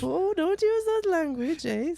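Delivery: high, slow, prim scolding mother; mock mom voice. Comic skit, mom scolding bully son's language, high prim voice. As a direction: gently scold like a doting mum.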